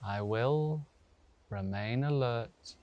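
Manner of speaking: slow, spacious relaxation voice with long pauses; guided meditation. Real, not acted. Yoga teacher settles students lying down, slow meditation guidance. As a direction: guide them gently into stillness, unhurried.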